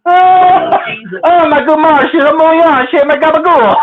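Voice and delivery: high, giggly catchphrase with a hint of Canadian accent; Canadian-tinged mob catchphrase. Husband repeating silly mob slang to wife, slightly Canadian accent. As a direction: recite it high, lightly Canadian, tickled by it. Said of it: "You even say it with a Canadian accent".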